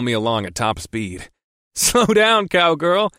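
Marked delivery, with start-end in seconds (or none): 1.7-3.1 high, loud chuckled tease; chuckling. Acted. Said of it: "I chuckled".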